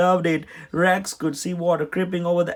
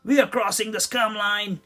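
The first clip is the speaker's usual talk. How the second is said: higher gleeful call; happy announcement; 7 semitones higher than usual. A narrator's reading. Chuck thrilled the water keeps rising, gleeful. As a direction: announce it with glee. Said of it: "Chuck said happily".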